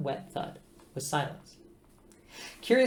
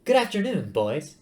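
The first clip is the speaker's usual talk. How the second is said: much higher, sing-song nanny greeting; matriarchal computer voice; 4 semitones higher, 5 dB louder than usual. Acted. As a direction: coo it like a bossy mother hen. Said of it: "I should do the voice differently".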